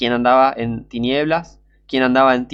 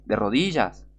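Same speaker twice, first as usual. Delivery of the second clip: usual speaking voice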